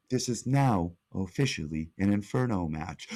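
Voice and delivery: low, measured computer announcement. Acted. Titantron announcing an Inferno match, low computer voice. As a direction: drop your pitch and imitate a computer voice. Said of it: "that weird computer voice".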